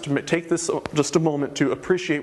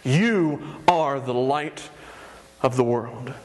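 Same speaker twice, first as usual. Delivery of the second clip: very slow, solemn declaration; Jesus declaring; 3 semitones lower, much slower than usual. Acted. Jesus speaks identity over the crowd, solemn. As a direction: let each word land like a proclamation. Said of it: "and he says".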